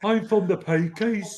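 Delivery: put-on Midlands accent. Joining the Peaky Blinders bit.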